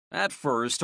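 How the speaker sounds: plain reading voice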